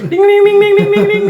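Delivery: very high ringing ding; bell imitation. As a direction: chime like a notification bell.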